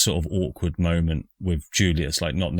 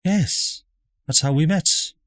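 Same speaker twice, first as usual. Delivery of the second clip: posh mother voice; 9 semitones higher than usual. Acted.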